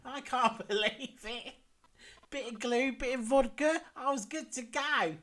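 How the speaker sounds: put-on high, giggly old lady voice; Dottie's voice. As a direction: play the tipsy old golfer. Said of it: "I can do Dottie's voice though".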